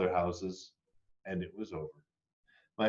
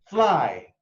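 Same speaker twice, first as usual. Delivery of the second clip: higher, louder urging cry; releasing cry; 6 semitones higher, 6 dB louder than usual. A narrator's reading. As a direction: send them off into the night. Child frees the fireflies.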